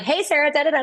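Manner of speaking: high, cheery chatter; breezy chatter. Retold or imitated. Business partner walking in, lightly voiced cheerful chatter. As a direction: chirp it carelessly like idle small talk. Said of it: "she's just chatting".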